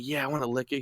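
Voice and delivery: very soft imitation of her answer; mock compliant reply. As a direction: murmur it back, playing along.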